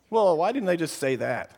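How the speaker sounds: usual speaking voice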